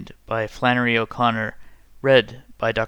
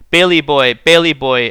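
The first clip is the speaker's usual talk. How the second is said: louder call across the woods; heartbroken call; 8 dB louder than usual. A narrator's reading. Grandmother calling her son from the ditch, heartbroken loud call. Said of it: "called ... as if her heart would break".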